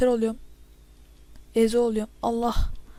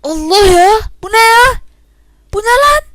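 yelling much higher and louder in outrage; frustrated yelling; 10 semitones higher, 12 dB louder, much slower than usual. Real, not acted. Gamer yelling at the game in disbelief, raging shout. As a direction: shout it at the screen like a furious gamer. Player is shocked by an in-game event.

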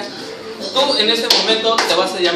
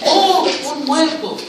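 high cry of discovery settling into a drawn-out call; demonstrated shock; 3 semitones higher, much slower than usual. Shown as a demonstration. Director shows policeman calling for an ambulance, hammed-up shock. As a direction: cry out, then call slowly for help.

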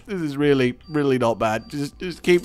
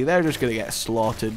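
ordinary talk, nothing marked

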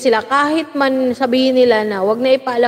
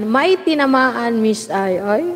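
usual speaking voice

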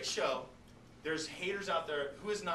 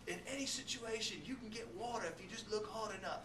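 lightly voiced TV host; 6 semitones higher, 4 dB quieter than usual. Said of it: "And he says".

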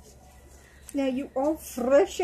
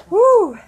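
pretend pregnant puff; 7 semitones higher, 13 dB louder than usual. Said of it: "so pregnant".

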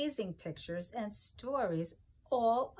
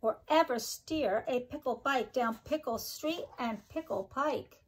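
sing-song rhyming read-aloud, each line bouncing; reading to children; 4 semitones higher, 4 dB louder than usual. Acted. Teacher reading a pickle rhyme book to her class, sing-song. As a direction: bounce the rhymes for little listeners.

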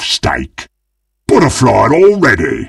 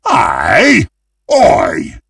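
usual speaking voice

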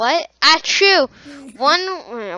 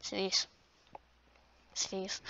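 very soft; 6 semitones lower, 21 dB quieter than usual. Pretend. Commanding the video to sneeze.

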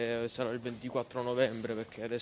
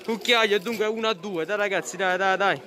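high, fast, excited live reporting; breathless italian goal commentary; 8 semitones higher, 5 dB louder than usual. Real, not acted.

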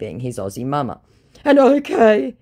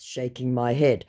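ordinary delivery

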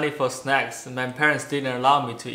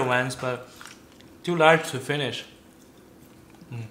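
speaking between bites; chewing while talking. Real, not acted. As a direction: mouth partly full. Seen 3: Man takes a snack mid-phrase, then bites and chews while talking.

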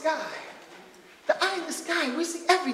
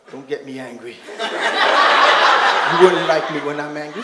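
slow low menacing warning, much lower pitch; Banner warning; 12 semitones lower, 6 dB louder, slower than usual. Retold or imitated. Banner warning the woman, slow and menacing. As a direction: growl it slow like Banner about to turn. Hulk quote, growling slow.

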